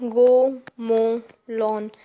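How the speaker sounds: "go mow lawn" very slow and loud, each word separate; teacher's loud, drawn-out stress on "go mow lawn"; stressed vowel sounds. Shown as a demonstration. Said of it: "the stress we put while pronouncing these words".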